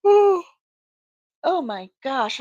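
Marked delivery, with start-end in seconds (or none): 0.0-0.4 sharp gasp then cry; startled gasp. Real, not acted. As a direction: gasp in surprise. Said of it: "I am so sorry".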